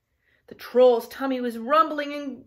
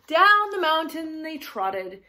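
usual speaking voice

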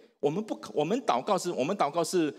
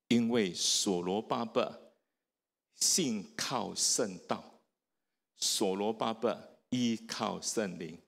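slow soft emphasis; 8 semitones lower, 4 dB quieter, slower than usual. Real, not acted. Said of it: "slow soft emphasis".

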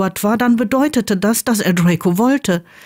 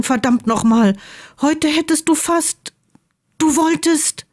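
agitated Draco; 7 semitones higher than usual. Acted. Draco voiced, shocked and upset.

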